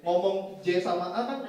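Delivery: normal talking voice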